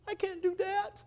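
high, thin reluctant voice; timid young woman. Acted. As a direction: shrink back from the call. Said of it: "And she says".

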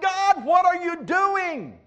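desperate plea. Retold or imitated. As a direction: drag out "God, what are you doing?" in anguish. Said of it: "he had this thought".

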